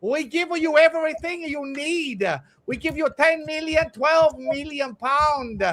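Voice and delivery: high, slow mock Italian manager voice; loud hammed-up Italian accent; generic Italian accent. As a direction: ham up the Italian boss bragging. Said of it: "massively generic, massively Italian".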